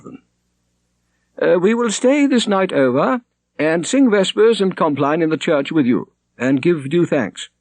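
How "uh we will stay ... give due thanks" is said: high, formal churchman's voice; the prior. A narrator's reading. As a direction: voice the prior as a high, stately cleric. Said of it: "said the prior".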